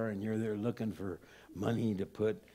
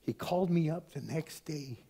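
ordinary talk

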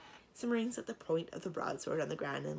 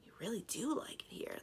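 hushed, higher wonder; 6 semitones higher than usual. A narrator's reading. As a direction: say it half-whispered, marveling. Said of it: "the knight said wonderingly".

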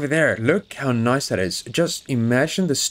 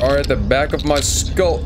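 usual speaking voice

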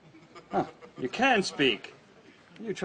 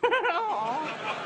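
high amused laugh; 12 semitones higher than usual. Acted. Laughs at Hector's remark.

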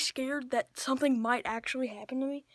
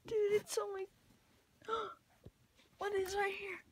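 frightened; 7 semitones higher, 5 dB quieter than usual. Real, not acted. Frightened in the dark.

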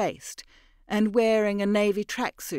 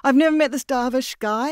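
higher and louder, pouring out; flustered confession; 5 semitones higher, 6 dB louder than usual. Acted. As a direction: blurt out the truth in fear. Mira confesses.

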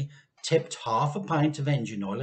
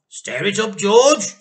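high, loud urgent shout; 7 semitones higher, 13 dB louder, slower than usual. A narrator's reading. Mr Cranky urging George at the saucepan, loud shout. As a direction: bark the order across the stove. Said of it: "shouted Mister Cranky".

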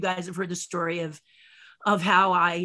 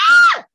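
very high, piercing mock scream; startled scream; 16 dB louder than usual. Star startled by the witch, very high loud scream. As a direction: shriek in sudden fright.

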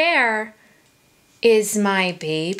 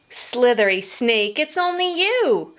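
usual speaking voice

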